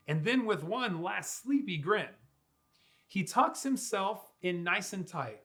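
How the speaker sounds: ordinary delivery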